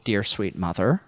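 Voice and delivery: normal talking voice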